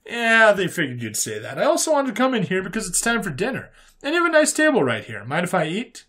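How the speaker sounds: high, lilting casual human voice; easygoing human character. Acted. Human visitor asking to eat at the prisoner's table, easygoing.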